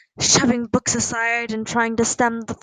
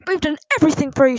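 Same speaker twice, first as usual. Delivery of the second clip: loud, rushed, higher-pitched plea; crying out; 5 semitones higher, 7 dB louder, much faster than usual. A narrator's reading. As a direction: cry out in hurt reproach. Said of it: "she cried".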